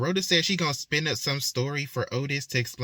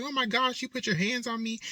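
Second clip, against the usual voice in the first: high-pitched, affronted shock; 10 semitones higher than usual. Acted. Shocked daughter to mother who hit back, high-pitched shock. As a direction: act out a stunned daughter, pitched way up. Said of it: "Jade is shocked".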